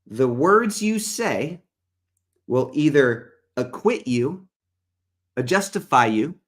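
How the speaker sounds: slow, emphatic Bible reading; measured preacher's reading with long pauses; scripture reading. Real, not acted. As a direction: read the verse out deliberately, weighing each word. Reads scripture aloud.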